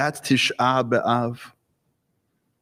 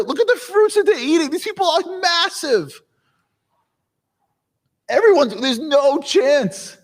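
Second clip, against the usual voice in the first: higher and higher, spiraling into panic; panicky spies; 12 semitones higher than usual. Acted. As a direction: wail it like terrified spies who can't fight giants. Scared spies report giant enemies.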